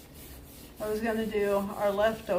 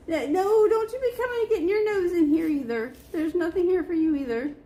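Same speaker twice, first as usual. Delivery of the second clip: high scolding; 8 semitones higher, 4 dB louder than usual. Real, not acted. Shooing dog from the paint.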